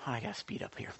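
hushed aside under the breath; whispered aside. Real, not acted. Preacher whispers an aside about speeding up. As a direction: drop to a whisper for a quick aside. Aside to audience mid-sermon.

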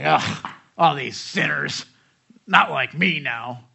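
slow, loud disgusted groan; smug disgust. Retold or imitated. Ministry student sneering at sinners, slow loud disgust. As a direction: drag it out like a smug new convert. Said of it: "he's kinda like".

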